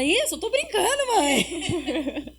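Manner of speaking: high mock teen protest; son protesting. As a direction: son laughing it off. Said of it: "E aí ele fala".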